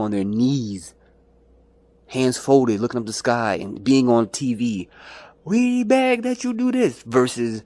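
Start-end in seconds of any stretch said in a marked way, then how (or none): 5.4-7.0 high, slow, pleading whine; mock pleading protester. Mocking protesters on their knees, high pleading whine. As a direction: beg like a kneeling supplicant, pitched up.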